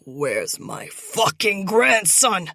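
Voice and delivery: loud, slow furious demand; angry demand. Acted. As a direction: spit out each word. Captor interrogates angrily.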